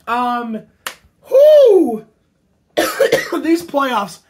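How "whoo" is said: loud high cheer; excited whoo. Real, not acted. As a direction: whoop for the team. Cheering for the Devils.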